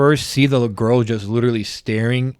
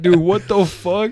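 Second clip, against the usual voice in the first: higher, slow, drawn-out disbelief; stunned disbelief; 6 semitones higher, slower than usual. Retold or imitated. Retelling to his co-host how they reacted to the creepy scene, stunned drawn-out disbelief. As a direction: let the shock stretch every word. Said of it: "me and him are losing our shit".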